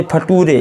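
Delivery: "फटूरे" sounded out with oo and a final ay; misread spelling pronunciation. Shown as a demonstration. Teacher warning learners off this reading, mispronounced. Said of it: "ऐसे नहीं पढ़ना है".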